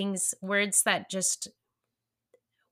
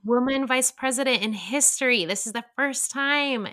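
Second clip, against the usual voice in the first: higher, louder, excited mom voice; thrilled, raised-pitch announcement; excited retelling to child; 7 semitones higher, 4 dB louder than usual. Retold or imitated. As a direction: point it out eagerly to a small child. Mother excitedly telling young son.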